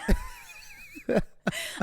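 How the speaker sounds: squeaky high laugh; high giggle. Pretend. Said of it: "matcha queen vibes".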